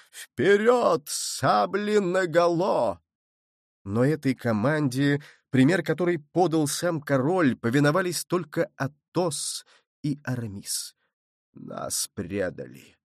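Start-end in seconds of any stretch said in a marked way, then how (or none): 0.1-3.0 high, long-drawn rallying shout; intoxicated battle cry. A narrator's reading. King thrilled by the charge, shouting to troops. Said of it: "крикнул тоже король, опьянённый этим видом". 11.5-13.0 very low, slow and soft; quietly. A narrator's reading. Betrayed king to his companions, quietly. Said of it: "тихо сказал король".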